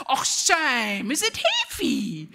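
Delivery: high, drawn-out teasing coo; mock sympathy. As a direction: parrot the sister's fake pity right back at her. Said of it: "guess what I was doing".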